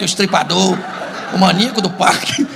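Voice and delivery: usual speaking voice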